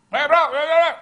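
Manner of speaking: loud, high holler to a buddy; shouted greeting. Acted. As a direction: hail a friend at full volume from afar. Acts out hailing friends from afar.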